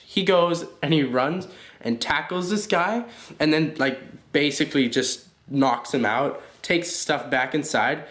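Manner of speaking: ordinary delivery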